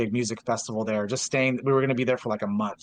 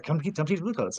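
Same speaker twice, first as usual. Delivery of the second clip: retold offer, pitched higher; insistent repeat; 6 semitones higher than usual. Friend repeats his offer to teach Blue Coats, insistent.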